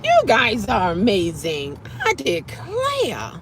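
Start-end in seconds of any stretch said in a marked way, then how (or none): 2.0-3.3 slow drawled I declare; a drawn-out southern-belle gasp; lazy mock astonishment; mock-genteel exclamation. Catchphrase drawled slowly.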